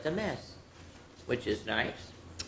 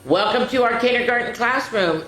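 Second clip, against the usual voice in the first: welcoming; 7 semitones higher, 4 dB louder than usual. Acted. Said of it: "with a big smile".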